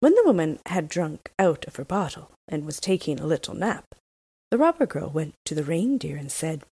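usual speaking voice

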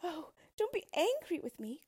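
high-pitched begging princess; frightened royal plea; pleading cry. A narrator's reading. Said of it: "cried the princess".

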